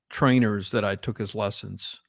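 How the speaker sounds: ordinary talk, nothing marked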